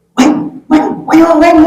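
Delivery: high-pitched mock scolding calls; hammed-up high "weh" calls; mock mother's yelling. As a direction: holler after the kids like a fed-up mother, put on. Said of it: "teriak-teriaknya tuh waktu ngatur anak".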